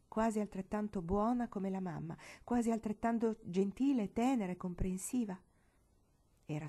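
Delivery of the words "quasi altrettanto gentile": plain reading voice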